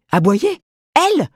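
high, loud indignant yelp of disbelief; scandalised cat. Acted. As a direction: spit out the words, affronted.

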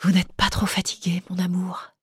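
soft veiled whisper, unvoiced; murmured. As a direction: breathe it tenderly, a hushed caress. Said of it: "une voix chère, un peu voilée, murmure".

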